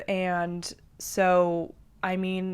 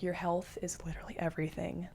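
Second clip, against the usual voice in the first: whispered aside; 11 dB quieter, faster than usual. Real, not acted. Heartfelt point, dropped to whisper.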